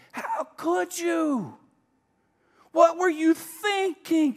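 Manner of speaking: high, loud, slow accusing scold; scolding rebuke. Pretend. Pastor mimics a scolding Jesus, high and loud. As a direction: hurl the blame like a disappointed judge. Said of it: "he doesn't say".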